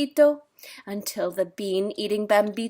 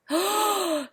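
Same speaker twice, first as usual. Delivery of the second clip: shocked gasp; 9 semitones higher than usual. A narrator's reading. Listener reacts in shock.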